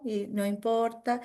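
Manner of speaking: normal talking voice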